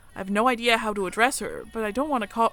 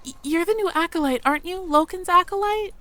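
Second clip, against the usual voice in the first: high, timid little mouse voice; a nervous young mouse working up courage; shy mouse girl; 8 semitones higher than usual. Acted. Mia the mouse approaching the human stranger, timid and high. Mia asks about the acolyte.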